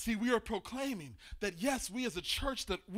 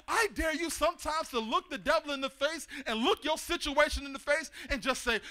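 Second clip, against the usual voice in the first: ordinary delivery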